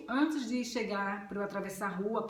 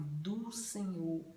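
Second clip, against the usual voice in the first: very soft, slow and drawn out; gentle voice of God; 3 semitones lower, 5 dB quieter, much slower than usual. Retold or imitated. God's words turning gentle after the strong declaration, soft and slow. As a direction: ease off into a soft, slow murmur. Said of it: "tipo, bem suave".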